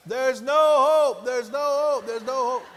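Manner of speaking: high, loud, slow wail fading down each time. Retold or imitated. Player wails at coach, loud and slow, giving up. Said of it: "I started talking louder".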